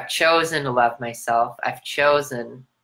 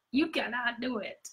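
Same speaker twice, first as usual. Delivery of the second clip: high and much softer, a far-off taunt; whispery cartoon taunt; 10 semitones higher, 10 dB quieter than usual. Cartoon villain repeating the taunt, high and hushed. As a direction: breathe it like a creepy ghost.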